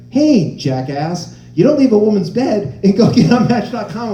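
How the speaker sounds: loud mock telling-off, drawn-out opening; angry girlfriend. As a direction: chew out a cheating boyfriend, hammed-up. Girlfriend angrily phones cheating brother.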